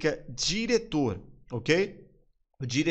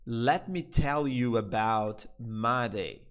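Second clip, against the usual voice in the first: slow, deliberate English model line; slow teaching repeat; 3 semitones lower, much slower than usual. Shown as a demonstration. Teacher to Brazilian students, slow, clear demo. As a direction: spell it out slowly for students. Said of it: "o pai dele então fala assim".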